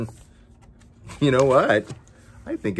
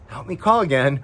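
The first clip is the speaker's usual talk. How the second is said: ordinary delivery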